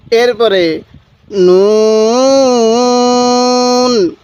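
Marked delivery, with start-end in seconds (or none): 1.3-4.1 নুন held very long; elongated recitation. Shown as a demonstration. Teacher reciting for viewers, নুন very slowly drawn out. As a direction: stretch the vowel slowly. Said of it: "এরকমভাবে শিখব".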